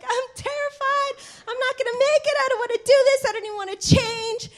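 high, trembling, panicked plea; terrified sobbing. Retold or imitated. A teen runner panicking to her teammates, high and tearful. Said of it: "I just started bawling".

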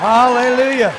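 loud, high hallelujah; preacher's shout. Real, not acted. Leading congregation in praise, loud shout. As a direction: lift it up joyfully.

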